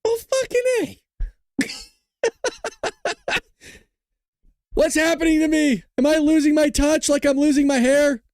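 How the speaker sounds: very high, exasperated wail; mock despair. Real, not acted. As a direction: whine about betrayal in jest. Mock despair at losing poll.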